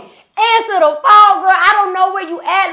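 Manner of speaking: loud, high-pitched exasperated demand; frantic mock yell. Lost driver calling her friend's phone, loud frantic re-enactment. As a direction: holler it down the phone, drawing out the first words.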